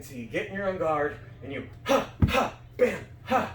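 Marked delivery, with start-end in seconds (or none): 2.3-3.5 choppy separate beats ha, bam, ha; each sound cut short and hit hard; staccato rhythm. Shown as a demonstration. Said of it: "that weird staccato rhythm".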